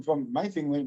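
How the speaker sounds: usual speaking voice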